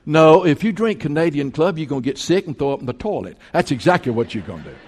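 ordinary talk, nothing marked

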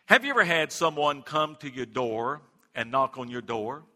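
ordinary talk, nothing marked

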